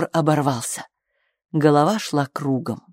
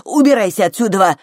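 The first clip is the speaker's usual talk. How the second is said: loud, higher dismissal; angry order; 4 semitones higher, 6 dB louder than usual. A narrator's reading. Flushed Flo ordering Tom out, angry order. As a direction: order him out sharply. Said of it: "Раскрасневшаяся Фло повернулась к нему".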